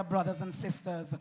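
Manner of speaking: usual speaking voice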